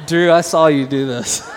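loud, laughing call to a listener. Real, not acted. Pastor teasing Drew in the crowd, laughing loudly. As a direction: call him out through laughter.

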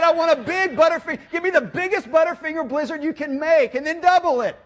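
loud, high, comically desperate order; worked-up demand. Retold or imitated. Dad ordering at the drive-through speaker, worked-up loud demand. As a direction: yell the order, pitch way up.

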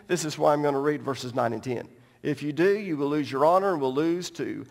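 normal talking voice

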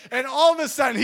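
ordinary talk, nothing marked

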